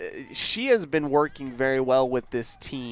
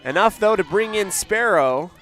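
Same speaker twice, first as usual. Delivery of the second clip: higher, louder lift on the scoring run; excited play-by-play; 6 semitones higher, 4 dB louder than usual. Real, not acted. Commentator announces a runner brought home, excited. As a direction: push the run across with energy.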